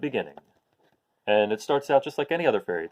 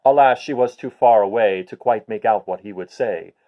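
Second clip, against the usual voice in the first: lively storybook narration; reading to children; 4 semitones lower, 5 dB louder than usual. A narrator's reading. Teacher reading the rhyme to her class, lively. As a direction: tell it brightly to the class. Reads picture book aloud.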